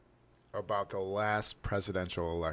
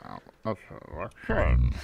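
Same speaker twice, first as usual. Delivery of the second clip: talking without moving the mouth; talking with mouth closed; 4 semitones lower, 5 dB louder than usual. Pretend. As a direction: keep the lips still, ventriloquist style. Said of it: "I'm gonna talk without moving my mouth".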